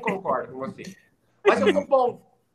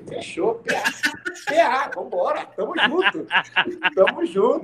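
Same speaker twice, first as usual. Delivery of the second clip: high, laughing hype; telling friends how he agreed to the affair, hyped; excited agreement; 7 semitones higher, slower than usual. Retold or imitated. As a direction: seal the deal with a happy whoop.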